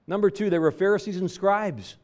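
high, loud pulpit build about the Pharisees; fiery preaching. Acted. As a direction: preach it out hard to the congregation. Preaching rises to full force.

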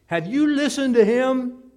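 loud, high retort; guards retorting. Acted. Temple guards retorting to the priests, loud and high. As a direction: throw the question right back.

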